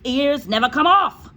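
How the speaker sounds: loud, high bark; loud scornful shout. A narrator's reading. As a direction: shout her down. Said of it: "the Trunchbull shouted".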